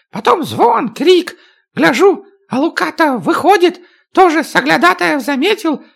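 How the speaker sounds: high, fast-talking little dwarf; lively dwarf voice, pitched high; dwarf's squeaky voice. Acted. Dwarf Chak telling his story.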